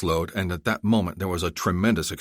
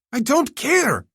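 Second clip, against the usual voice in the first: much higher, louder defiant shout; 5 dB louder, slower than usual. Acted. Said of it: "Zolkadar shouted".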